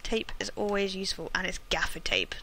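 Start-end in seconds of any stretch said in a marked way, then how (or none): 1.3-2.3 whispering. Real, not acted. Excited find while looting.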